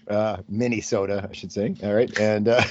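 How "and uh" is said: ordinary talk, nothing marked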